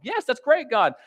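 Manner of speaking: high, chirpy friendly questions; hammed-up cheerful chatter; mock eager small talk. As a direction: play an overeager evangelist chatting up a mother. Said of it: "And it's like".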